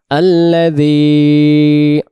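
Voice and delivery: slow drawn-out tajweed recitation; measured Quranic chant. Shown as a demonstration. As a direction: recite each word slowly and carefully. Recitation of next verse.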